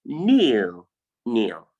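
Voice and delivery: kneel with a long EE, then a shorter one; long and short vowel. Shown as a demonstration. Teacher contrasting long and short vowels for students, long then short. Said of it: "which one is short".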